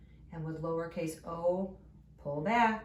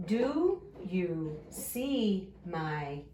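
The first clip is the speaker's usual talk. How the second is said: very slow, each word stretched; drawn-out early-reader pacing; word-by-word reading; much slower than usual. Shown as a demonstration. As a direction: read along slowly, one word at a time. Sounding out a sentence word by word.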